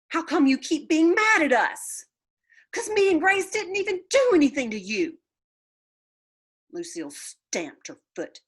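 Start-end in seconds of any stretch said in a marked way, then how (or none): 0.1-5.2 put-on indignant child. A narrator's reading. Girls confronting Lucille.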